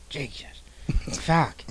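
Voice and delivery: ordinary talk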